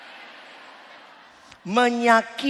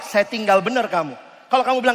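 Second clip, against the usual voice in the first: normal talking voice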